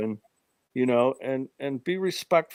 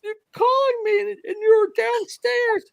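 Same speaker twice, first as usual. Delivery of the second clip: much higher, shrieking in mock panic, near tears; retold terrified scream; 3 dB louder than usual. Uncle retells niece's scream from the third floor, high panicked shriek. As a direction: play the panicked eleven-year-old screaming for her uncle. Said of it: "she screamed bloody murder".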